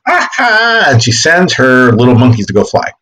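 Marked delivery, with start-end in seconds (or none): 0.1-0.8 high gloating witch "Ah, ha"; a quick hammed-up witch gloat; witch's triumph. Teacher voicing the witch sending her monkeys, high gloat. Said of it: "she kinda goes".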